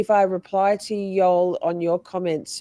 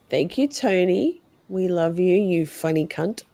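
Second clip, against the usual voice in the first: ordinary talk, nothing marked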